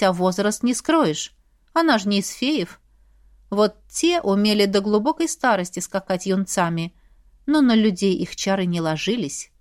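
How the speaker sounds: usual speaking voice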